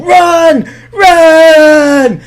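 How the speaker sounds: loud, high, urgent yell; shouting run. Retold or imitated. Owen yells to the others while dinosaurs chase, loud panicked shout. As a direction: bellow it as if fleeing.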